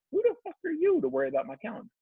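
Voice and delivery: a quick, high, hushed imitation of a touchy thought; voiced inner thought. Husband quoting the thought about his wife, lightly mimicked indignation. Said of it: "as a thought popped up that said".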